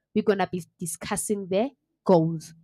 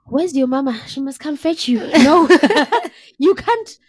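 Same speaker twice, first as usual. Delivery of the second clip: incredulous teasing; 6 semitones higher, 8 dB louder than usual. Real, not acted. Teasing friend who eats cereal all day.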